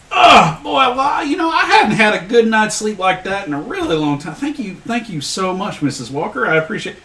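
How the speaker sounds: put-on high-pitched, thick Appalachian accent; Chuck's Appalachian accent. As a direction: stretch and thank her in a high Appalachian twang. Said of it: "you sound like fucking Chuck".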